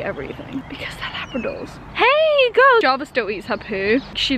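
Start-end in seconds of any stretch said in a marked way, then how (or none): 1.9-2.8 high, loud coo; calling the dog. Real, not acted. Calling her dog in a high coo. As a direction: sing out to the dog.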